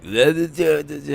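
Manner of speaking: high, far-off "duh, duh" like a clueless person; mock dumb mumbling. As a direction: play dumb and babble it up high. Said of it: "Motherfuckers just be like".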